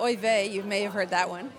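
usual speaking voice

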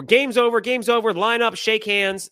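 high, projected call. Retold or imitated. Game ends, players told to line up, calling out.

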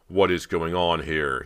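loud, slow, frustrated demand; suppressed anger. A narrator's reading. Bo questions the crew after the accident, contained anger. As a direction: demand it slowly, holding anger down. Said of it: "trying to force down his anger and frustration".

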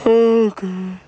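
squeaky high waking-up okay; high startled okay. Real, not acted. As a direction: pitch it way up, just awake. Just woke up, groggy.

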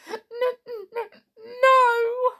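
stammering through sobs, high; sobbing. A narrator's reading. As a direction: choke out the no, sobbing. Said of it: "sobbed the clown".

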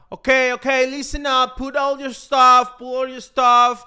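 loud, high barked English orders; imitating airport security officer. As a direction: bark the orders like a strict TSA agent. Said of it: "cuando me empiezan a hablar así". (imitando a un guardia de seguridad gritando órdenes)

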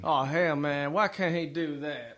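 slow Texan cowboy drawl; Texas accent. Shown as a demonstration. Cowboy musing on riding the desert, slow Texas drawl. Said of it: "kind of the Texas accent".

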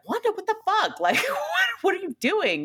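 high, incredulous mock outburst; exasperated Vision. Vision scolding Wanda over open magic, high incredulous voice. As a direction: throw up your hands at her. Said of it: "And Vision's like".

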